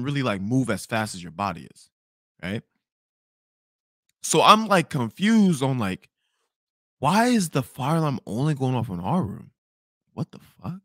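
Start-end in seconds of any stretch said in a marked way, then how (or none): none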